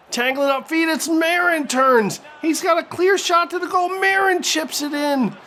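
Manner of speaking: rising play-by-play excitement; excited commentary. Real, not acted. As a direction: pitch climbing high as the chance builds. Goal chance in live commentary.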